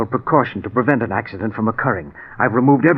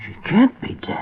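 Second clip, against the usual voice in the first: anguished disbelief; 8 semitones higher than usual. Acted. Husband refuses wife's death.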